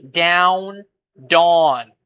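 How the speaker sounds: Down said with its ow sound, then dawn said with its aw sound, each on its own; say the two words apart. Shown as a demonstration. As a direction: Down and dawn as a slow deliberate comparison. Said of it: "The word with the A sound".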